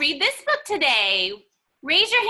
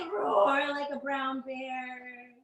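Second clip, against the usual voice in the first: soft slow bear roar; 4 semitones lower, 7 dB quieter, much slower than usual. Shown as a demonstration. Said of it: "curl down and say".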